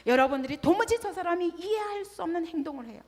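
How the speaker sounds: ordinary talk, nothing marked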